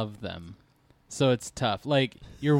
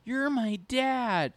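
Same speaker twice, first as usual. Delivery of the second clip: put-on high, slow, drawn-out gushing child; adoring daughter; 12 semitones higher, much slower than usual. Dad imagines a first-day hug, mock adoring daughter. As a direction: imitate a child's dreamy adoration. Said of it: "you hope that they'll ... say, like".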